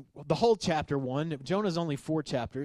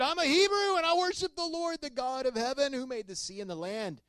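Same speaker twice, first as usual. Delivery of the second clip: Jonah; 11 semitones higher than usual. Acted. Jonah answers sailors.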